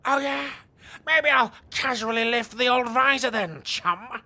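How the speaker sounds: high, loud goading menace; taunting threat. Acted. Knight taunts the robber before lifting his visor, loud and goading. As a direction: dare him to look under the visor.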